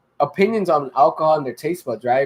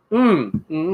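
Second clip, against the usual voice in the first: humming with a mouthful of beer; mouth full. Real, not acted. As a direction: hum through a mouthful of beer. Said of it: "I didn't wanna drink what I had in my mouth".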